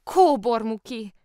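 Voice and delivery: slow, loud, high cry of the name; drawn-out exclamation. A narrator's reading. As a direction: stretch the name out in astonishment. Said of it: "kiáltott fel aztán másodszor is".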